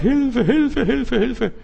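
high, laughing mock wail; mock cries for help. Preacher mimicking Hagar's cries, high and laughing. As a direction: ham up a helpless cry.

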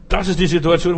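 ordinary talk

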